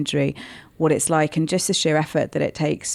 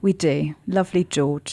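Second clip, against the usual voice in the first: posh voice; slower than usual. Acted. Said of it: "I say it with such a posh voice".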